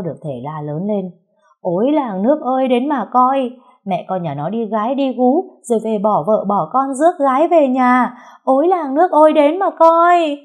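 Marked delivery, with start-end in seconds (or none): 1.6-10.4 high, very loud wail to the neighbours; screaming out. A narrator's reading. Aggrieved mother-in-law screaming for the whole village to come and look. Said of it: "Bà Thao gào lên".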